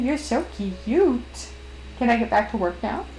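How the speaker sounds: ordinary delivery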